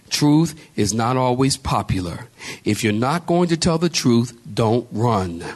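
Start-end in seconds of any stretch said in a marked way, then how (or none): none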